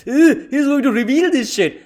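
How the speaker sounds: high whiny mock groan of an admiral; whiny Sengoku. Mocking the admiral's annoyed groan as the reveal starts. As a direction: sneer it like a peeved old admiral. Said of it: "He's just like".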